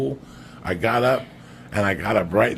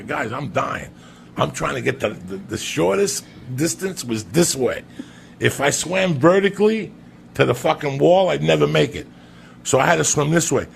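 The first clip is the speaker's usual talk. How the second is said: loud, strained, higher pleading; desperate pleading; 6 semitones higher, 5 dB louder than usual. Retold or imitated. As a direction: beg like you're drowning. Retells pleading, out of breath.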